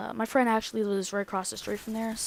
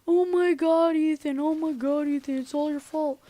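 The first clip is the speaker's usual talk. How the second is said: high, loud tearful blame; hammed-up distraught complaint; mock crying; 6 semitones higher, 4 dB louder than usual. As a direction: imitate upset girls wailing in accusation. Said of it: "So they're both crying".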